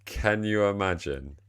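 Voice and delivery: ordinary delivery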